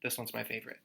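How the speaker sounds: usual speaking voice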